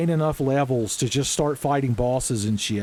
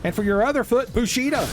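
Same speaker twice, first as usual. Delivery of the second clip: playful taunt; 10 semitones higher, faster than usual. Pretend.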